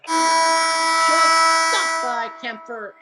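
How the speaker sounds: loud, slow, high triumphant call; drawn-out play call. Real, not acted. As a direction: stretch it out like the big final save. Dramatic final save call.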